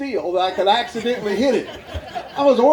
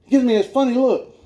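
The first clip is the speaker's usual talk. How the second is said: ordinary delivery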